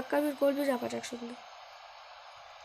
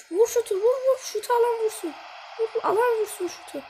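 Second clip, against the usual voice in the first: high, loud, repeated shouts; frantic urging; 8 semitones higher, 4 dB louder than usual. Real, not acted. Gamer to whole team to shoot, frantic urging. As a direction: yell for anyone to take the shot.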